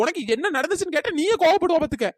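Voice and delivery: ordinary talk, nothing marked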